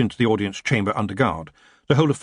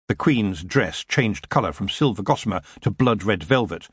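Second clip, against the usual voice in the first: ordinary delivery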